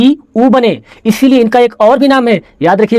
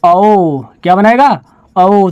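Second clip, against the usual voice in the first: ordinary delivery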